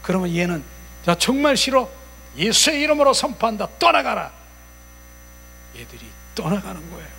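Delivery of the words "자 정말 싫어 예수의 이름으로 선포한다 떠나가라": loud, high-pitched banishing command; vehement rejection. Shown as a demonstration. Believer ordering the spirit to leave, vehement rejection. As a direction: drive it out with open dislike. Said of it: "이렇게 싫어하라고요".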